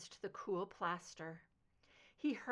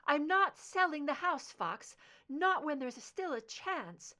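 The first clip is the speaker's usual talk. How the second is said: raised, stubborn insistence; insistent refusal; 7 semitones higher, 5 dB louder than usual. Acted. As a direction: refuse him, voice rising. Mother refuses to sell house.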